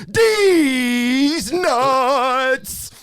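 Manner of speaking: drawn-out, high, full-volume holler; gleeful stretched shout; prank punchline yell. Acted. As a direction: blast the punchline, stretching each word. Prank punchline yelled at caller.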